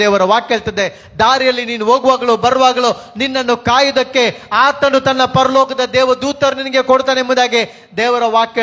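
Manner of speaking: sustained, insistent sermon delivery; impassioned preaching. Acted. Preacher assuring listeners of angels guarding their way, impassioned. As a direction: preach it out with steady force.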